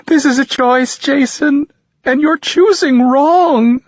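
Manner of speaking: high, loud, slow tearful accusation; mother accusing her son through tears, high, loud and slow; crying mother. A narrator's reading. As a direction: sob out the accusation. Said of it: "I listen to her cry when she says".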